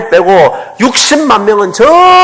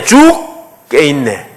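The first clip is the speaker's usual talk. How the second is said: very slow, stretched 죽게 있네; slow demo; 6 semitones lower, much slower than usual. Shown as a demonstration. Preacher showing the congregation how to say 죽게 있네, very slow. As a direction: draw 죽게 있네 out slowly as a prayer. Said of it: "이거를 빨리 기도로 바꾸세요".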